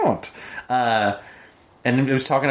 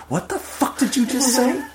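mock outrage. Scene partner reacts to confession.

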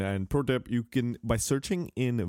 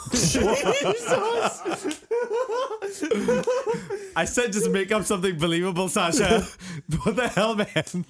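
laughing in shock; 9 semitones higher than usual. Real, not acted. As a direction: laugh out in disbelief. Shocked by Sasha's joke.

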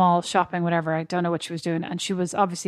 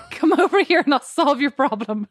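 laughing; 5 semitones higher than usual. Pretend. Joking she'd barge in on a crying stranger, laughing. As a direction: giggle through a mock offer of help.

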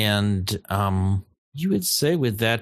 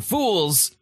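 lightly voiced illusion meant to scare intruders off; booming warning; 7 semitones higher, slower than usual. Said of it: "scare you with a booming voice".

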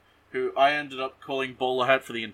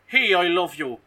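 raised, louder mock-Irish lilt; Irish accent; 5 semitones higher, 5 dB louder than usual. Man declaring love to his lady, in an Irish accent. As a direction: put on a playful Irish accent. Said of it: "'Cause he's Irish".

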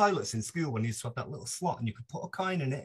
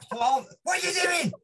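high, loud startled demand; retold outburst, pitched way up; exasperated yell; 5 dB louder than usual. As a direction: yell it across the hotel room at night. Startled roommate yells in retold scene.

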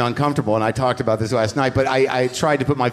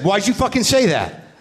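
higher mock fury at the heckler; retold angry confrontation; 6 semitones higher than usual. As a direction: berate him like a bully caught out. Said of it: "I said".

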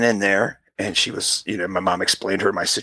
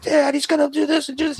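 put-on high-pitched frantic kid; mock-desperate, voice pitched way up; panicked plea. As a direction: act out a child pleading with dad. Acted child appealing to dad.

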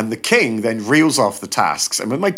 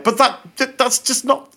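much higher, sputtering protest; spluttering disbelief; 13 semitones higher than usual. Retold or imitated. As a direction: splutter it out, lost for words. Man outraged at tie shop.

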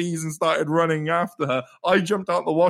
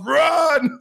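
sudden high, loud yell; retold shout; 12 semitones higher, 5 dB louder than usual. Actor playing Clancy yells at two players to flee, loud shout. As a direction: bellow it like the actor saving you. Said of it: "rugby tackles this guy into the wall and shouts".

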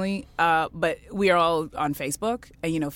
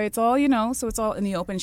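usual speaking voice

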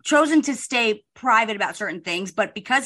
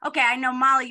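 usual speaking voice

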